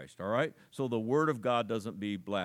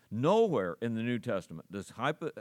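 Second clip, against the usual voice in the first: ordinary delivery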